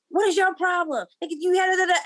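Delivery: high exasperated scolding, imitating herself; scolding friend. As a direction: tell him off like a fed-up friend. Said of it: "I'd be like".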